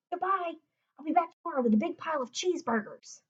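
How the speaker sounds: put-on an eager kid promising to come back, fast and bright; boy saying goodbye to his hidden pet, quick and eager; young Lewis voice.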